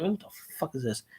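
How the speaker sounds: baffled mutter. Retold or imitated. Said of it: "I'm like".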